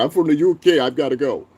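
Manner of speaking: lightly voiced mock UK commenter; mocking British chatter. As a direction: ham up a fleeing snob. Mocks UK chatter leaving.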